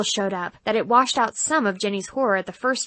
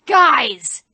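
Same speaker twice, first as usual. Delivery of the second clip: loud call for backup; 4 semitones higher, 8 dB louder than usual. Acted. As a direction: shout across the room. Devil calls for help.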